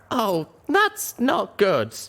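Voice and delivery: slow, high, bemused; dazed understatement. A narrator's reading. Wounded pony noticing the spear through her leg, dazed understatement. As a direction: state it with dazed calm.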